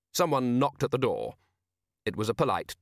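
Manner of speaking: ordinary delivery, nothing marked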